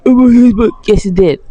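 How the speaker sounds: mock an indignant fan, high and loud; hammed-up outraged viewer; mocking imitation. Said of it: "someone like".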